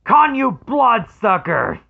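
loud high-pitched shouted line; movie quote shout. Retold or imitated. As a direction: belt it out like the furious film hero. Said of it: "That quote I heard".

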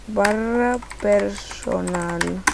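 very slow, drawn-out syllables; typing aloud. Real, not acted. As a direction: stretch each word as you type it. Dictates to self while typing.

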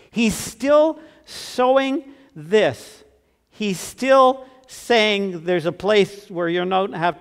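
slow, soaring sermon refrain; preaching at full force. Acted. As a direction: stretch each word out, calling to the room.

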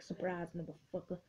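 soft, low aside as the mines; low, understated taunt; sly voiced quote. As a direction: drop down low and quiet for the ambush. Voicing the mines in game.

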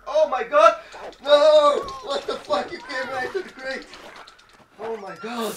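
loud, high, laughing disbelief; real shocked shouting with laughter; shocked yelling. Real, not acted. Wolf charges straight into crate.

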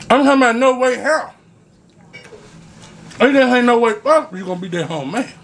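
chewing while talking; talking between bites; mouth full. Real, not acted. Seen 0: Bites bacon, forks eggs into mouth, food visible mid-speech.

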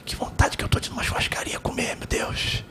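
hushed, unvoiced wishful whisper; whispered longing. Mother wishing to herself for a barbecue meal, whispered longing. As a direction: whisper a private craving to yourself. Mother's private wish, retold.

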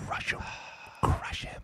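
hushed, conspiratorial whisper. Retold or imitated. Player whispering to the coach to crush the rookie, hushed. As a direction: whisper it like a secret order.

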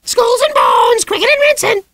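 shrieking parrot squawk, very high and loud; parrot screech. A narrator's reading. The parrot screeching at the piano, a shrill squawk. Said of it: "The parrot shrieked in parting".

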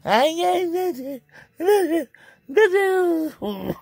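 high, slow whining wail; mock-cry the words out; hammed-up high wail.

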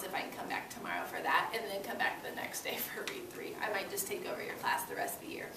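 playful whispered aside. Real, not acted. Teacher whispering a joke aside to the class, playfully. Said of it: "whispered aside to class".

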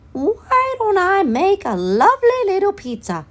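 high chirpy hen voice. A narrator's reading. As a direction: squeak it out like a little hen. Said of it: "she said to herself".

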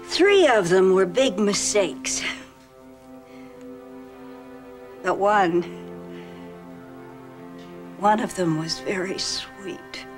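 slow, low, sorrowful; wistful. Real, not acted. Sad memories of husbands.

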